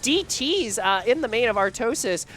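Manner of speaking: high, loud shocked outburst; surprised. Real, not acted. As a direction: gasp it out in disbelief. Sudden surprising game moment.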